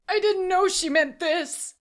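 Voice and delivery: high, loud, gasping protest; gasped. A narrator's reading. Auctioneer excusing himself to the assassin, gasping. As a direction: gasp it out in horror. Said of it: "he gasped".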